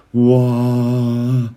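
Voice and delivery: mock spellbound whoa in a changed voice; a hint of being talked into a trance; dazed whoa. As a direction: sound lightly hypnotized. Imitating someone lulled by smooth talk.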